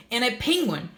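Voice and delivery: put-on high, excited little girl's voice; playful delight at the clouds; a happy kid spotting shapes; giggly girl. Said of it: "Gertie giggled".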